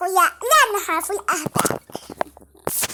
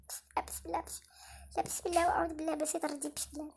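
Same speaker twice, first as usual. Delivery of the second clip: hushed, voiceless prayer, fast at the end; frightened whisper; 5 semitones lower, 18 dB quieter than usual. Real, not acted. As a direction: whisper the prayer so nobody hears you. Hearing the noise again, hushed.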